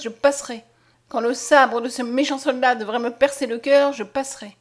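loud, high, defiant declaration; impassioned outcry. A narrator's reading. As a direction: vow it with mounting insistence. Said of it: "s'écria Mariette".

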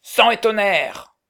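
slow, booming pirate oath; cavernous voices. Acted. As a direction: intone it like a hollow cave. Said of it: "Deux voix caverneuses répliquèrent en chœur".

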